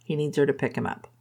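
ordinary delivery

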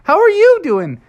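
put-on high, loud chorus of kids calling hello; a whole class shouting a cheerful hello; class greeting. Classmates greet the new student in a high, loud chorus. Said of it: "everybody in the class said".

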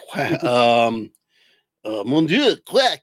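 put-on French accent quack, mon Dieu; french chef. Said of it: "Now say in the accent, [name]".

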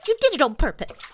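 high, loud, stuttering accusation; spluttering. A narrator's reading. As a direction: splutter as if through soapsuds. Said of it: "spluttered Piglet".

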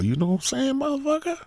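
squeaky-high mock question; high-pitched needling. Host joking at [name], high-pitched needling question.

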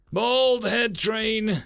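slow loud call, high. Acted. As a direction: announce it to the room. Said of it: "Samson called out".

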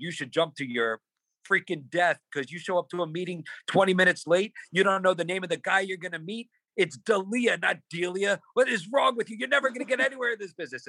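high-pitched tirade building in pitch; mock furious dressing-down; retold yelling. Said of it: "He's yelling at me so much".

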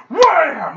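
loud, high "wham"; shouted impact noise. Retold or imitated. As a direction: punch out a sudden smack. Imitates a smack to the head.

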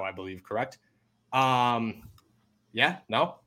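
usual speaking voice

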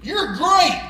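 dad's retold shout, lightly done; calling out. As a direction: call it out to raise an echo. Dad calls out to raise echo.

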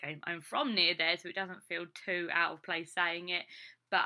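normal talking voice

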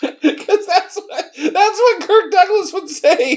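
high, laughter-choked explanation; can hardly get it out for laughing. Real, not acted. Co-host explaining the Kirk Douglas joke, helplessly laughing. Cracking up over the Douglas bit.

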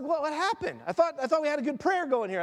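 a bewildered God asking where the prayer went; playful God voice. Pretend. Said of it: "and God's like".

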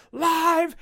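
high, loud yell at the automated line; yelling at the phone. Retold or imitated. Caller yelling at the automated phone line, loud and high. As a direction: shout it at the phone, fed up. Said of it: "I'm sitting here yelling at the phone".

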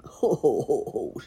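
mock wolfish chuckle; sneering laugh. A narrator's reading. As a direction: laugh scornfully as the wolf. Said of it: "sneered the wolf".

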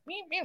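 high squeaky soft meows; soft high imitated cat calls; mock meow calls. Imitating guide's animal calls.

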